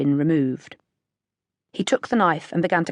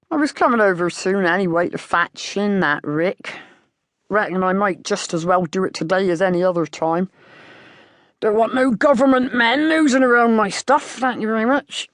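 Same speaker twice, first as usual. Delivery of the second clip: grumble, then bristle at the government men; gruff farmer; 3 semitones higher, 4 dB louder than usual. A narrator's reading. Farmer refusing government men on his land, indignant country voice. Held farmer voice, narrator reading.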